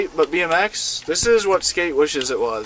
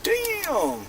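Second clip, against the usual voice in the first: surprised; 14 semitones higher, 5 dB quieter than usual. Real, not acted. Surprised at peak viewer count.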